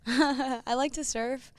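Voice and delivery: laughing through the words; a light laugh as she answers. Real, not acted. Laughs while answering fun question.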